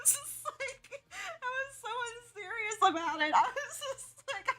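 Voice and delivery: laughing too hard to speak properly. Real, not acted. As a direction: squeak it out while laughing. Laughing at own silliness.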